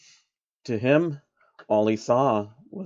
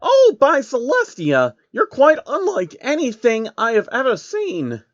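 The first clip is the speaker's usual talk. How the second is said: high, wondering princess voice; a pony princess amazed by a stranger; awed princess; 11 semitones higher, 4 dB louder than usual. Acted. Luna voiced in the story.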